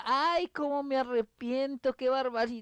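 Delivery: hammed-up lament, half-acted; mock crying regret. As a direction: cry out as the current takes the car. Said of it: "me pongo a llorar".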